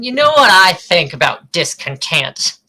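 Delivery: hammed-up tipsy rant; drunk person. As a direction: play a rowdy drunk slamming down a beer. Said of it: "I make a good drunk person".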